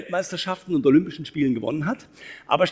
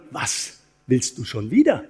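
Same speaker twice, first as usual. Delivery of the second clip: slow, lower, weight on "wieder"; stress on wieder; 5 semitones lower, slower than usual. Shown as a demonstration. Speaker shows the audience a third meaning by stressing "wieder". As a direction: land hard on "wieder" as if fed up. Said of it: "Oder man könnte auch sagen".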